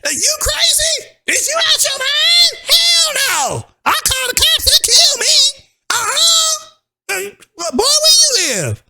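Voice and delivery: put-on very high, slow, shouting old woman; scornful old lady. To the agent, an outraged old lady shouting scorn about the cops. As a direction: shriek her scorn like an outraged grandma.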